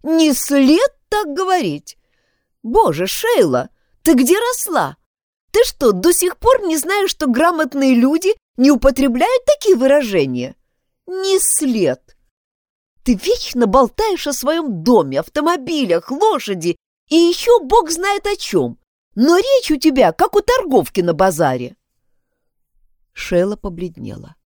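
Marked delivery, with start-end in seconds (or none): 0.0-2.0 mimicking a prissy voice, slowed; mocking imitation. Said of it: "Только послушайте Шейлу". 2.6-21.8 angry scolding. Acted. Jennet furiously scolds Sheila.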